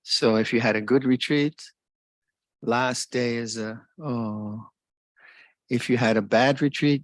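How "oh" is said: soft, deflated little sigh of regret; wistful, quiet "oh"; mild mock disappointment. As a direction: let a small "oh" sink, let down. Acting disappointment at retreat ending.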